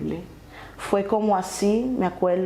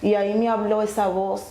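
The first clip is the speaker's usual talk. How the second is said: ordinary talk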